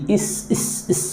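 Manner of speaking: "इस" softly repeated on a drawn S; hushed sound demo of "इस". Shown as a demonstration. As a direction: softly isolate "इस" as a short i plus hiss. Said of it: "तो इसकी ध्वनि निकलती है".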